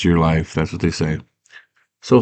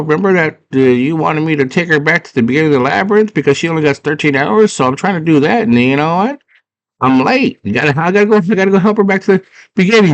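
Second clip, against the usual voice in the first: high-pitched flustered cartoon voice; hammed-up high, fretful stammering; nervous excuses; 9 semitones higher than usual. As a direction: babble excuses as a cowardly little helper. Nervous Hoggle excuses himself to Jareth.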